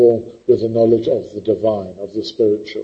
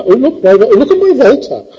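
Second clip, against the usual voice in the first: much higher, preening voice showing off; mocking a vain poet, pitched much higher; 11 semitones higher, 4 dB louder than usual. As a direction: parody a smug poet admiring his own Volta. Mocking show-off poets.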